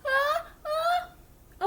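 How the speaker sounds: building sneeze. Acted. Gerald trying to answer Piggy, about to sneeze. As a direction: hold back a sneeze that keeps coming.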